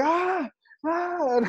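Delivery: high, drawn-out "ah" cries; triumphant yell. Acted. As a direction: crow wordlessly at the cheering crowd. Re-enacting soaking up the applause.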